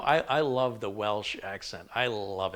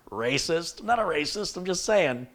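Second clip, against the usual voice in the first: high, loud mock indignation; hammed-up defensive outburst; mock defensive protest; 8 semitones higher, 7 dB louder than usual. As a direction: protest the imagined charge, pitch leaping up. Acts out defensive protest at accusation.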